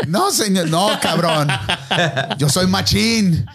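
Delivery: laughing, slow macho no; laughing refusal. Retold or imitated. Him refusing the bearded guy, laughing. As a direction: push back while laughing.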